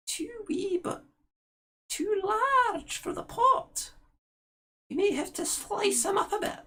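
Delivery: high Scottish crone mulling over the pot. Acted. Crone Ethel tells her sister the boy is too large, high Scottish voice. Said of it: "did you become Scottish".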